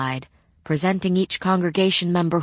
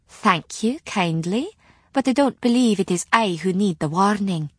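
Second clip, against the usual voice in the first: twinkling Scots retort; Scots accent; 4 semitones higher than usual. Acted. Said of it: "with a twinkle in her grey eyes".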